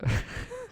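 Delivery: high-pitched squeaky giggle; a sudden high burst of laughter. Real, not acted. Laughs at own story.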